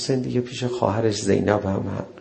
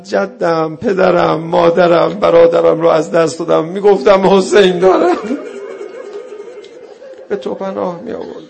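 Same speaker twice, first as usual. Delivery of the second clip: building to a loud wailing cry; 6 semitones higher, 7 dB louder than usual. Retold or imitated. Zainab cries out to Hussein for her lost family, loud wail. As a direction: call out each lost one louder and louder. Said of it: "صدا زد".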